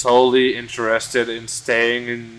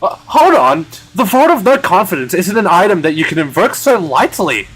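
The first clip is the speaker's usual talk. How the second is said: higher, louder protest; indignant objection; 7 semitones higher, 6 dB louder than usual. Acted. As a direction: cry out against it, voice swooping up. Voicing an objecting character.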